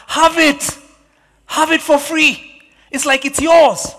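high, loud, drawn-out exclamations; eager generous offer. Acted. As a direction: cry out a delighted gift to a king. Acts Araunah offering land to king.

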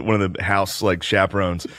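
ordinary delivery

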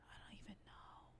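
breathy whispered despair; barely a whisper. Real, not acted. Streamer defeated by the game, whispering.

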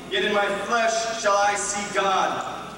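ordinary delivery

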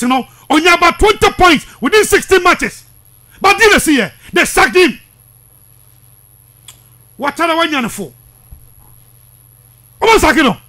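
normal talking voice

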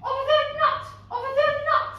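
loud, high, abusive cry; cried out. A narrator's reading. Prince insulting Cindy in the kitchen, crying out. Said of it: "The prince cried".

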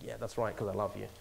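low, very soft reassurance; reassuring parent. Retold or imitated. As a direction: comfort your child quietly after discipline. Parent reassuring son after discipline.

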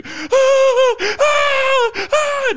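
long high terrified screams; shrill unending scream of a scared kid. Retold or imitated. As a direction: scream in pure fright, high and loud. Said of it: "to scream and scream".

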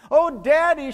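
put-on high, loud panicked squeal of a little girl; little girl shrieking to her father over a bee, terrified. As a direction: shriek for Daddy as if the bee is on you. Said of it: "she squealed in terror".

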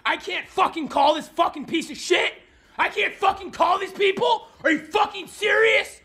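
rising pitched angry shouts; real rage, yelling at nobody in particular; furious yelling. Real, not acted. Man raging that Uber Eats hung up, furious shouting. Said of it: "Dude, you're about to have a stroke".

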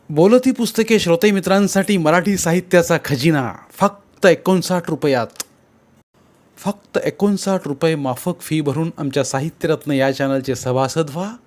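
bright, upbeat promo voice; ad read. Acted. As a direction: sell the membership to listeners. (जाहिरातीचा उत्साही आवाज)

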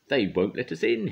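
loud, high-pitched indignant protest; hissed complaint. A narrator's reading. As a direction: complain loudly as an aggrieved engine. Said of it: "hissed Gordon".

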